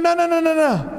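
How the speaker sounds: high, hammed-up no no no; humble act of a scheming prince; mock modest refusal. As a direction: wave off the bow with fake humility. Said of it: "he put out his hand".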